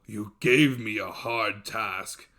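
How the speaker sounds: slow, weighty monster voice; a giant thinking hard; slow, pondering dev. Acted. Dev to the lad, slow and heavy.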